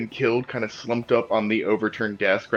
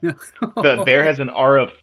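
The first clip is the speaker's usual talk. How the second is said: incredulous, higher and louder; astonished question; 6 semitones higher, 6 dB louder than usual. Real, not acted. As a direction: check it in loud disbelief. Disbelief at the huge aura.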